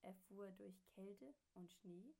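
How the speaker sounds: ordinary delivery, nothing marked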